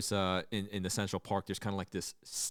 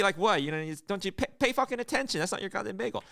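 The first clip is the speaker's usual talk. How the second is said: mock rant; 9 semitones higher, faster than usual. Mocking the cashier's rant.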